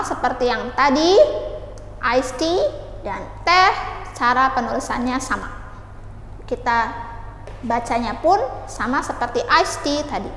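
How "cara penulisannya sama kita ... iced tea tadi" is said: ordinary talk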